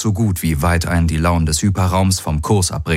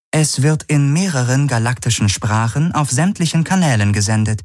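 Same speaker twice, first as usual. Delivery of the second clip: higher-pitched computer report; measured synthetic announcer, pitched up; artificial ship voice; 6 semitones higher than usual. A narrator's reading. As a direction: read it out as the ship's AI. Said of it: "die künstliche Stimme der Vago".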